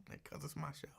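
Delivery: a hushed whispered aside. Real, not acted. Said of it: "whispered aside".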